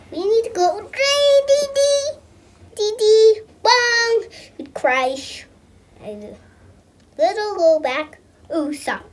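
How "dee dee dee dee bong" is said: sing-song sound effects. Pretend. Kid making game sound effects.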